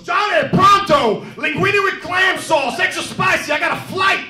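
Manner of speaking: shouted Pacino order. Retold or imitated. Pacino rushing in to demand his pasta, bellowing. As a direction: yell the order across the room. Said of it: "He goes".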